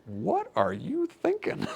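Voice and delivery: high-pitched mock disbelief; incredulous. Mocking doctors who burn the vagus nerve, in high-pitched disbelief. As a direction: ask it as if the idea is absurd. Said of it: "It's like".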